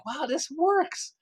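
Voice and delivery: high, slow, drawn-out wow; slow high-pitched astonishment; amazed. Retold or imitated. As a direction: marvel at it like a discovery. Voicing people's amazement.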